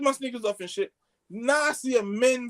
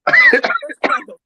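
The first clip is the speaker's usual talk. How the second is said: high, loud, drawn-out disbelief; shocked high cry; 13 dB louder, slower than usual. Real, not acted. Friend stunned by the condom story, shocked high cry.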